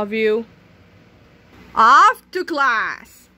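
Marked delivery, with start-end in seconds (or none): 1.7-3.3 loud, slow, drawn-out command; stern order. Acted. Substitute orders the kids off to class, booming and slow. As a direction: send them off, booming.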